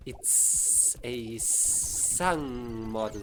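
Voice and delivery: slow hissing snake voice. Pretend. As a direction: hiss the words out like a snake. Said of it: "now you're just making a snake voice".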